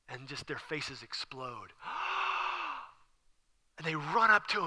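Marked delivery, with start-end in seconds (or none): 1.9-2.8 long, high gasp of astonishment; acted wide-open gasp; amazed gasp. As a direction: suck in air in joyful shock. Faces explode on seeing Jesus alive.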